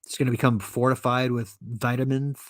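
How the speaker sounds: ordinary delivery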